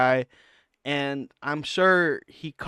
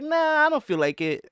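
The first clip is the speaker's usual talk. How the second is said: high-pitched, dismissive imitation; imitating someone dodging a challenge, pitched up; mock brush-off; 11 semitones higher than usual. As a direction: wave it off in a much higher mock voice. Mimicking Brendan dodging a challenge.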